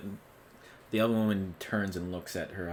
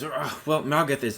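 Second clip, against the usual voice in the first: higher, louder eager blurt; a pompous man jumping in; blustering husband; 7 semitones higher, 6 dB louder than usual. Acted. Husband butting in to answer the party, eager blurt. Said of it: "sitting next to her goes".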